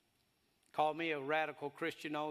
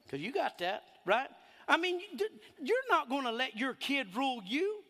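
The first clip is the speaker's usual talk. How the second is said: ordinary delivery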